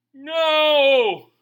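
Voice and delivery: loud, high, flat-out No; emphatic no. Real, not acted. Preacher to congregation, a loud firm no. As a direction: slam the door on the idea.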